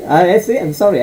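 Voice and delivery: ordinary talk, nothing marked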